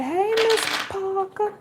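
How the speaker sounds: high, slow smooth-talker greeting; playful put-on voice, high and slow; flirty greeting. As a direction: croon it like a postman sweet-talking her.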